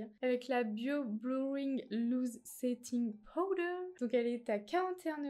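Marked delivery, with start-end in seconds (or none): 0.6-3.8 slow English brand name in an English accent. Real, not acted. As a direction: spell out the English product name carefully. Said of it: "excusez-moi pour l'accent anglais". (nom anglais prononcé à l'anglaise, lentement)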